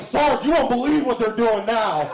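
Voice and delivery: high, loud, whining report to the boss; tattling cop voice. Retold or imitated. A comic imagining the cop reporting to his sergeant, in a high, loud, whining voice.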